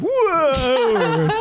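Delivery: a high, laughing startled whoa as a wave hits. Retold or imitated. Retelling the proposal, he yelps with a laugh at the wave. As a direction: yelp with a laugh as the wave knocks you. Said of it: "and I'm like".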